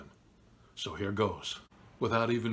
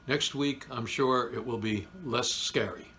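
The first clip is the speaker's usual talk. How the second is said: ordinary talk, nothing marked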